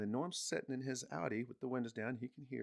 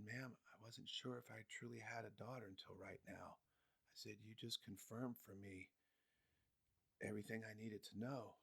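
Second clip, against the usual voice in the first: stunned hush; 16 dB quieter than usual. Retold or imitated. Stunned quiet reply.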